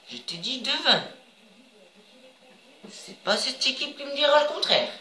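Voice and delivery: high-pitched, rising insistence; playful insistence. Real, not acted. Gamer arguing with his own match, high-pitched insistence.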